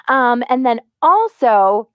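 ordinary talk

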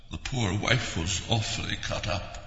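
low ghost voice. Acted. Dignam lower.

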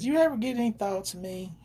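a hint of a Southern girl asking shyly; southern accent. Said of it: "with her southern accent".

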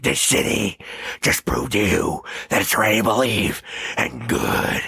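throaty growl with blocked-off nose; throaty masked-vigilante impression. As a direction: growl it through a stuffed nose. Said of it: "like a throaty growl".

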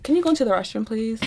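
soft, held-back politeness; restrained request. Retold or imitated. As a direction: ask quietly with forced composure. Composed request after calming down.

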